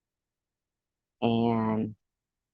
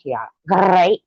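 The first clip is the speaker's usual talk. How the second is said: mock Tony the Tiger; 5 semitones higher, 4 dB louder than usual. Shown as a demonstration. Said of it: "That sounds weird".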